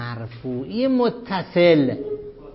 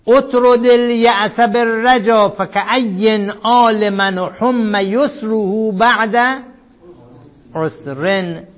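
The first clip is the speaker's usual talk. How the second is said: slow, louder chanted reading of the poem; slow, loud reading of the Arabic verse; verse recitation; 4 semitones higher, 7 dB louder, much slower than usual. Shown as a demonstration. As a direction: declaim the verse slowly.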